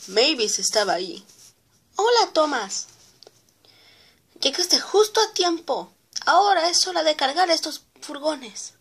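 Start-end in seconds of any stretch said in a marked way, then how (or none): 1.9-8.7 bright cheerful engine. Acted. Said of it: "Muy bien, Mavis".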